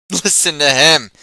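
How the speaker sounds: loud, high mocking shout; jeering shout. Rogue jeering at the Cimmerian for the tavern crowd, shouting. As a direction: shout to the room, jeering at him. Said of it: "he shouted jeeringly".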